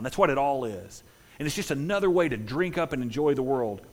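normal talking voice

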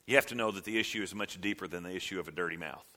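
ordinary talk

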